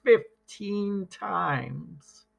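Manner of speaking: slow, stretched, high and trailing; drawn out. A narrator's reading. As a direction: stretch it out for comic length. Narration echoing the wail.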